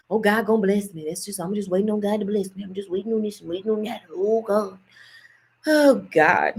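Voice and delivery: low, soft, lazy churchy sigh; lightly mock a passive believer waiting on God; mocking church folk. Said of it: "made you think that God just".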